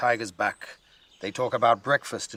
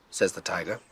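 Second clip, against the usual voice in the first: plain reading voice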